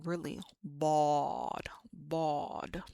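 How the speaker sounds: "bawd" slow, with an opened throat. Shown as a demonstration. Teacher coaching an emphatic D from the throat. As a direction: drop the throat and drag out "bawd". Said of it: "open up the bottom of your throat".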